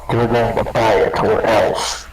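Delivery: loud, slow, heavy threat; menacing threat. Retold or imitated. Elf barbarian forcing the villager to buy, loud slow menace. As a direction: lean in and threaten him.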